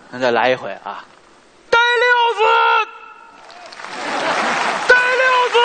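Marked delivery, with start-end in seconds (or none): 1.7-5.6 bandit's summons. Pretend. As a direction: yell for the henchman across the hall.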